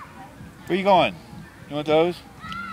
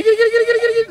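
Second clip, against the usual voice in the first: high kitty call; 8 dB louder, much faster than usual. Acted. Coaxing the bird to do kitty.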